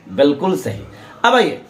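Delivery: ordinary delivery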